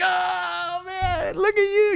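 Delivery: imitated laughing greeting, very high; drawn-out high squeal, as if cracking up; mock laughing exclamation at the sight of someone; Jim. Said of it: "he answers the door laughing".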